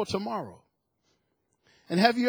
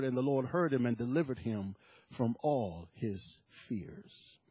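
hushed whispered scripture; preacher whispering the psalm to the congregation; 8 semitones lower, 9 dB quieter than usual. Acted. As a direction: breathe the verse out like a secret. Quoting psalm, drops to hush.

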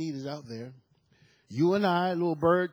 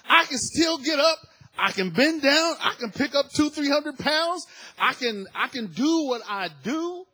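loud, high preacher shout; full-force sermon cry; fervent preaching; 9 semitones higher, 8 dB louder than usual. Real, not acted. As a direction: preach it out with fire.

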